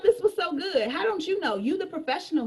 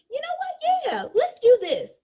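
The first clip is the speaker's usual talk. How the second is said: high, loud excited agreement; a customer excitedly agreeing to join; eager yes; 10 semitones higher, 4 dB louder, slower than usual. Acted. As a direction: jump at the chance.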